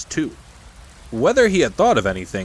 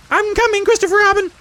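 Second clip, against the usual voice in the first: very high, loud excited squeak; Roo squeaking out; 7 dB louder than usual. Acted. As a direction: squeak it out at the top of the voice. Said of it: "he squeaked out".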